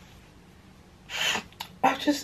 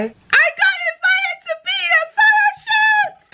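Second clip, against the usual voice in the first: thrilled squeal of big news; overexcited squeal. Real, not acted. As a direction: blurt it out, squealing with real joy. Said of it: "Sorry, I got too gassed".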